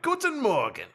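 put-on Guten Morgen in a thick, high American accent; American-accented German. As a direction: greet in German like an obvious tourist. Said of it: "in the most American way possible".

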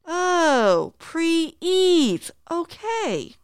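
high, drawn-out "oh, I get it"; dawning realisation. Acted. Reader acts the narrator finally understanding the group leader, dawning realisation. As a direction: let the penny drop, pitched well up. Acted sudden understanding in read dialogue.

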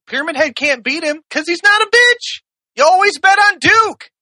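very high, rousing proclamation; impassioned climax. Real, not acted. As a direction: drive the argument home at full pitch. Climax of heated defence.

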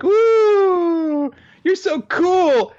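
high, loud fangirl imitation; adoring squeal. The girls fawning over Naputaku, adoring high squeal. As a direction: gush with a drawn-out mock cheer. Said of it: "are acting adoring".

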